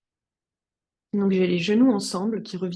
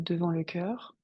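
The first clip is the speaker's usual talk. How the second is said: guided meditation; 2 semitones lower, 6 dB quieter than usual. Acted. Yoga teacher guiding students to settle on the mat, meditative calm. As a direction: settle them calmly into stillness, unhurried.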